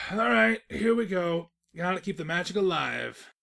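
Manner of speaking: high, loud pep-talk; rally yourself to carry on; loud self-encouragement; psyching up. Acted. Narrator psyching himself up.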